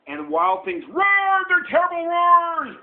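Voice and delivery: high, loud story climax; dramatic storytelling. A narrator's reading. Parent reading the wild things' roaring, loud and dramatic. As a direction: build to a loud, high roar of words.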